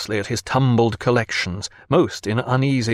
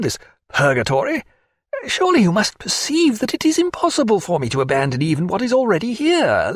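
higher, exasperated scholar; fussed naturalist; 4 semitones higher than usual. A narrator's reading. Naturalist refusing the lieutenant amid his crates, exasperated and higher.

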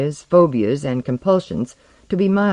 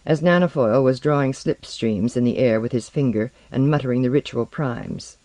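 ordinary delivery, nothing marked

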